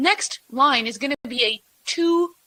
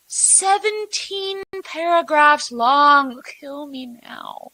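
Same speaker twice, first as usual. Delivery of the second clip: mock despair; much slower than usual. Real, not acted. Exasperated at long pitches.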